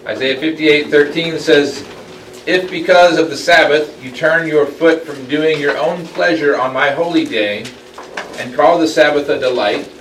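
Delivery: loud, high proclaiming of the verse; dramatic scripture reading. Acted. Teacher reading Isaiah's warning to the class, loud and proclaiming.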